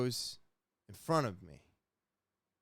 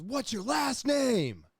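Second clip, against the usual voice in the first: higher, louder, slow demand; officer yelling; 12 semitones higher, 5 dB louder, slower than usual. Retold or imitated. Armed officer yelling for his last name. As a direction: yell it down the barrel of a gun.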